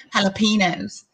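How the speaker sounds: Jalapenos with a flat English a and no ñ; imitating an American saying Jalapenos; gringo pronunciation. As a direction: say Jalapenos like an American, hala-PEE-nos. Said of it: "como dicen jalapeños aquí".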